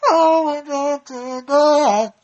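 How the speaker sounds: high, drawn-out theatrical yell; very loud, hammed-up show title; booming title announcement. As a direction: belt out the segment name, every word stretched. Shouts the segment title again.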